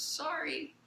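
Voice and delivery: put-on small, high kid voice; young boy apologizing. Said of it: "the kid goes".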